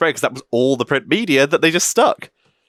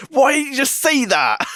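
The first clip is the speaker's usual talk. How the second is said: acted exasperation; 13 semitones higher than usual. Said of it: "It's like".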